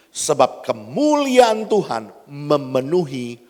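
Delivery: loud, emphatic Bible reading; scripture proclamation. Acted. Preacher reading the verse to the congregation, loud and emphatic. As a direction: declaim the verse with force. Reads scripture aloud at force.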